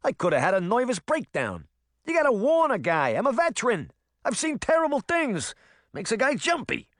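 a nervous cat veteran in broad New Jersey; rattled New Jersey accent; thick New Jersey accent. Acted. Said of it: "in a thick New Jersey accent".

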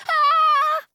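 loud high-pitched scream; frightened cry. A narrator's reading. Girl screams as the dragon barrels at her, crying out. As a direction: scream of alarm. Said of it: "I cry".

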